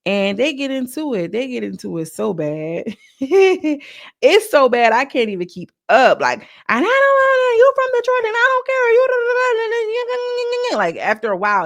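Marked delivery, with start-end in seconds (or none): 3.2-4.2 high, loud real laugh; laughing at the reunion fight; burst of laughter. Real, not acted. Laughing at how bad the fight got. 6.4-10.8 much higher, loud frantic yelling, imitating the fighters; imitating the shouting match between Summer and Jasmine; screaming rant. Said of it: "a bunch of run-on string sentences".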